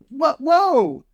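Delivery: high, loud, sudden "what? whoa!" as the rod jerks; startled surprise. Retold or imitated. Angler startled by a fish nearly ripping the rod, surprised shout. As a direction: caught off guard by a hard strike, voice jumps up. Retelling a big fish strike.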